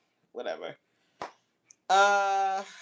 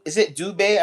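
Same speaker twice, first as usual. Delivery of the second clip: usual speaking voice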